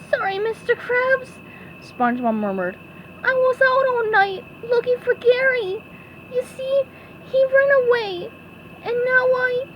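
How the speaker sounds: squeaky sponge, tired and sorry; SpongeBob voice. Acted.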